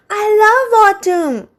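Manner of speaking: a little cat declaring his love of autumn; Pete the cat, happy. A narrator's reading. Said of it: "Pete says".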